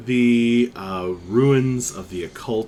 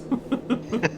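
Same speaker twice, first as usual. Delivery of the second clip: amused laugh; 12 semitones higher, 5 dB quieter than usual. Real, not acted. Amused at own wording.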